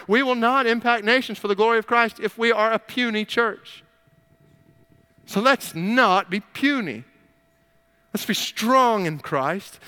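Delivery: rally the room, drive each line home; preaching at full force. Acted. Pastor urging the church not to be puny, at full preaching force.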